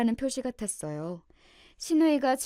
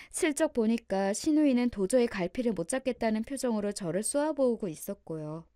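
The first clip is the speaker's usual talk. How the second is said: usual speaking voice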